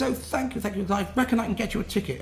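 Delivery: high, gushing mock thanks; imitated grateful girl. Girl thanks her supposed rescuer, imitated high gratitude.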